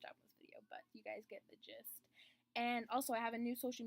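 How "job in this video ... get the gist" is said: whispered, much softer aside. Real, not acted. Vlogger apologising to viewers for the rushed video, whisper. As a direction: drop to a whisper.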